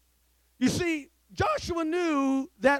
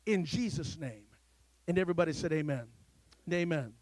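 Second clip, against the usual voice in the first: lower, reverent prayer voice; 4 semitones lower than usual. Acted. Pastor praying over the young people before the sermon, reverent. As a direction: pray it humbly to the Father.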